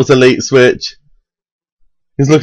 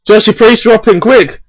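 urgent call; 9 semitones higher than usual. Real, not acted. Urging teammate mid-fight.

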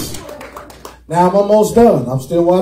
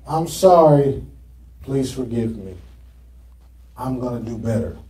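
soft sincere apology; 10 semitones lower, 7 dB quieter than usual. Shown as a demonstration.

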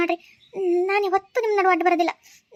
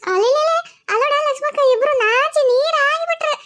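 much higher, playful teasing; 8 semitones higher, 3 dB louder than usual. Acted. Woman teasing the blushing couple, high and playful. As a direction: coo it out like an aunt teasing the shy pair.

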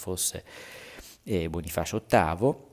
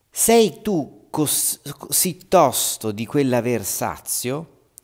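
much higher, louder, very slow taunt; mocking accusation; 6 semitones higher, 8 dB louder, slower than usual. Nicolò taunting Bonifacio for his greed, slow and high. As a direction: drag out the sneering question.

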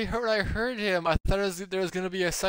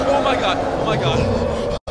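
loud panicked exclaim; 3 semitones higher, 5 dB louder than usual. Real, not acted. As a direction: blurt it out in panic. Escaping the monster.

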